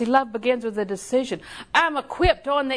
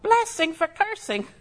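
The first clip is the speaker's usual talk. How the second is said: higher, slow, disbelieving echo; incredulous question; 8 semitones higher, slower than usual. Retold or imitated. Preacher recalling her own disbelief at the verse, incredulous. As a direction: repeat it as if you can't believe it. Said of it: "I thought".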